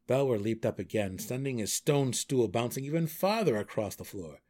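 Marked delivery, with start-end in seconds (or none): none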